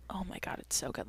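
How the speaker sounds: soft awed whisper. Real, not acted. Looking at film stills, she whispers in delight.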